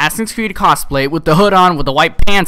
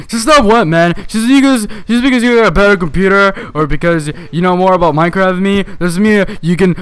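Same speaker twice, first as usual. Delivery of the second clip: put-on higher, louder, defensive kid retort; indignant whine; 5 semitones higher, 4 dB louder than usual. Cosplay kid snapping back at the narrator, indignant and higher. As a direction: snap back like an offended kid.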